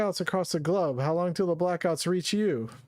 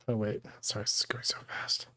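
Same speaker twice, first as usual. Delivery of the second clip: hushed whisper, breathy and quick; whispered aside; 5 semitones lower, 5 dB quieter than usual. Real, not acted. Reader flustered by fast text, whispering an apology.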